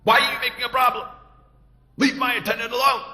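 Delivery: high, loud scolding yell; angry shout. Acted. Guji angrily shouting down at the gate. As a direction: bark at the troublemaker. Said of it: "Guji gets very angry".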